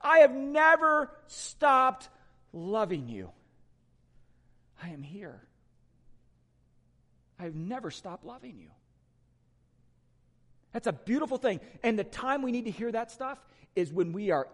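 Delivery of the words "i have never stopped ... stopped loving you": reassuring. Retold or imitated. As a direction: reassure like a loving parent. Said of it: "here he reassures them".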